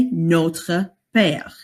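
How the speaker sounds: "notre père" said carefully in French, with a French r; French pronunciation. Shown as a demonstration. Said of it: "you would say".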